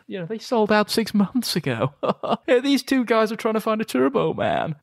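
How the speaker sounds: high, jeering voice; mocking shop staff. Shop staff laughing at the dads, high and jeering.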